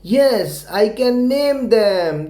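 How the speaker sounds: put-on a clear model sentence for kids to copy; slow model answer. Shown as a demonstration. Teacher showing pupils the answer to say, slowly. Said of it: "लेकिन आपको ऐसे बोलना है बस".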